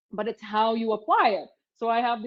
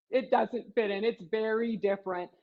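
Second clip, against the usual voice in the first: normal talking voice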